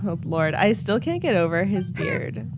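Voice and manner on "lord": ordinary talk, nothing marked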